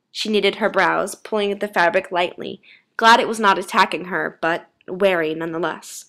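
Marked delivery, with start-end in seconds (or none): none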